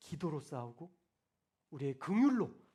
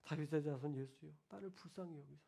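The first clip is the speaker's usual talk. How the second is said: hushed, lower pleading prayer; friend's plea; 5 semitones lower, 11 dB quieter than usual. Retold or imitated. Retelling a struggling friend's prayer, hushed and pleading. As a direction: quietly beg for mercy. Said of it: "제 가슴속에 박혔어요".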